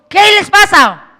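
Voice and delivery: a scolding preacher's bellow; mock stern shout. Jesus shouts at frightened disciples in the storm, loud rebuke.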